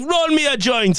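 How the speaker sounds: a hint of a commanding old Nigerian grandma; bossy grandma. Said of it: "She was like".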